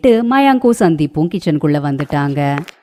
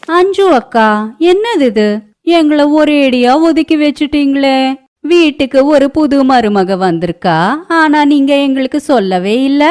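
high, loud, put-out neighbour voice; high-pitched aggrieved neighbour; reproachful neighbour; 9 semitones higher, 4 dB louder than usual. Acted. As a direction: complain as a snubbed neighbour, pitched well up.

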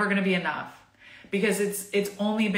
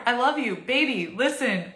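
ordinary delivery

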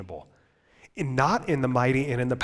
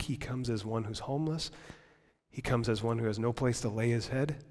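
soft; 6 dB quieter than usual. Real, not acted. Hushed litany about Jesus.

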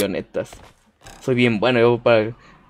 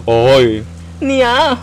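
macho yell demo. Shown as a demonstration. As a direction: show them how the men scream. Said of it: "ahorita van a ver cómo gritan los machos".

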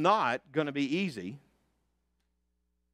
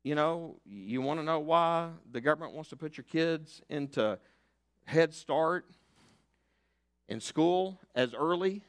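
ordinary delivery

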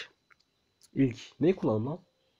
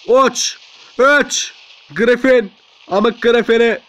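loud, higher outburst; battle yelling; 6 semitones higher, 5 dB louder, much slower than usual. Real, not acted. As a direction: yell at the enemy robot. Yelling at game enemy.

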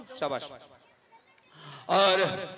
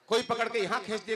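ordinary talk, nothing marked

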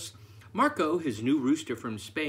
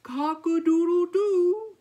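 high, loud, sustained crowing; rooster crow; 8 dB louder than usual. A narrator's reading. Marco the rooster finally waking, high loud crow. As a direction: crow it out on one held high pitch. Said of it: "yawned Marco".

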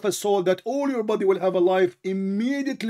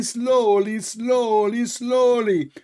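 higher, mocking sing-song repetition; mocking slowly; 6 semitones higher, much slower than usual. Scoffing at his friend's account of Adam, higher mocking repeat. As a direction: parrot the other man's words back with scorn. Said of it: "But now you just said to me".

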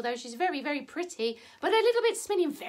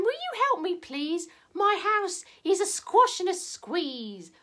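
put-on higher-pitched fretful old lady voice; grumbling old lady; 3 semitones higher than usual. Old lady complains of her cramped house, grumbling.

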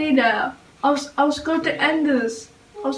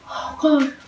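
usual speaking voice

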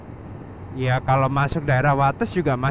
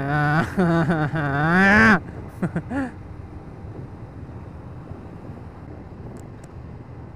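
big laugh. Real, not acted. Rider reacting to the bad road, big laugh. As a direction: burst out laughing, tailing into chuckles.

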